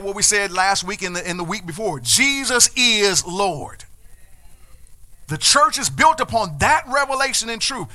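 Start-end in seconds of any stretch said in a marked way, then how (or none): 2.0-7.9 loud, high, proclaiming; full-throated sermon proclamation; preaching at full force. Acted. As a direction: declare it to the church with power. Preaching climax.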